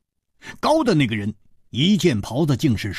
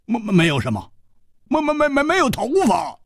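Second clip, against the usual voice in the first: stuttering, climbing high; flustered stammer; 11 semitones higher, faster than usual. A narrator's reading. Hu Tiehua taken aback by the dwarf, stammering. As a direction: trip over the first word repeatedly, pitch rising. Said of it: "胡铁花愣了一愣".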